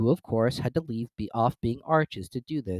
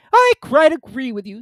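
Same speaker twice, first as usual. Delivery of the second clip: put-on high, loud fussy old duchess; 12 dB louder than usual. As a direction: prattle like a silly duchess. Duchess character voice.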